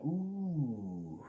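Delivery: long sympathetic "ooh", sliding down; imitated wincing "ooh". Another truck driver reacts to the hit, imitated wince. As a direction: draw out the ooh like an onlooker wincing. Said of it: "Another truck driver went".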